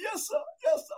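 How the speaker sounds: high-pitched imitation of a crying player; hammed-up whimpering obedience; mock tearful submission. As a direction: give in as if crying. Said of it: "I'm crying now".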